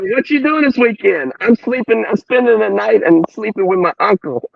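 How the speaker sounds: high-pitched schoolkid impersonation, played for laughs; high, teasing imitation of kids at school; mock schoolkid chat. Imitating schoolkids chatting about sleepovers.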